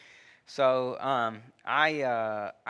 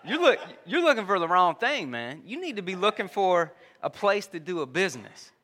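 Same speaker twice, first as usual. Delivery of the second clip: lightly voiced divine buddy, louder and higher; emphatic, casual telling-off from above; God; 5 semitones higher, 4 dB louder than usual. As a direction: urge it firmly, like a blunt friend setting someone straight. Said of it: "I think God is talking to me".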